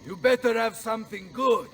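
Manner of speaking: normal talking voice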